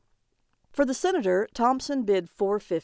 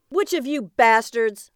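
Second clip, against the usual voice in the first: louder, higher angry demand; 4 semitones higher, 6 dB louder than usual. A narrator's reading. Thompson confronts the other agents, angry. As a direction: demand it with anger. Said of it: "his face beet red with anger".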